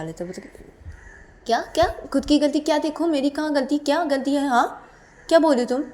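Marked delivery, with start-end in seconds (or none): 1.5-5.8 high, loud protest; indignant acted retort. Character challenges partner who blamed them, indignant. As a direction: fire back questions, high and loud.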